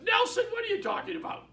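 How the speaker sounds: Pam shouting back in amused shock; surprised shout. Retold or imitated. Pam answers the office intercom prank with a surprised shout.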